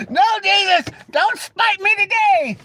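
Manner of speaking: high, slow whiny plea; praying not to be struck down; mock pleading. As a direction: beg heaven in a squeaky whine. Begging Jesus jokingly.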